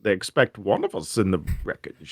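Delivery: low, muffled villain impression, hand over mouth; Bane voice. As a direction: speak low and soft through a cupped hand. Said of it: "you gotta put your hand over your mouth too".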